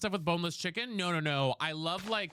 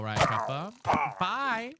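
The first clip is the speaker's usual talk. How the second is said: usual speaking voice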